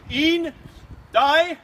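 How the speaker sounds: loud, rising countdown call; shouted countdown. Real, not acted. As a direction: count them in, shouting each number.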